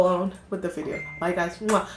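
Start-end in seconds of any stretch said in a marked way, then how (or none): 1.6-1.9 kiss sound. Real, not acted. Blowing kisses goodbye.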